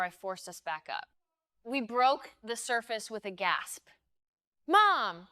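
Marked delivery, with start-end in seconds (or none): 4.7-5.3 panicked cry for mom, high and loud; frightened child calling out; child's scream. A narrator's reading. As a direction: scream out for your mother. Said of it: "Daisy screamed".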